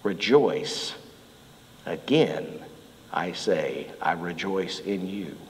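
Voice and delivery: gentle blessing to a friend; tender rejoice. Shown as a demonstration. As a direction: say it softly to someone you are grateful for. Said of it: "you show them the words".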